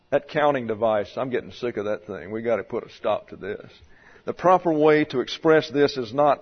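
ordinary talk

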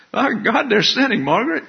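high-pitched scandalized gasp to a companion; mock shocked churchgoer. Preacher mimics a scandalized churchgoer to Margaret, mock shock.

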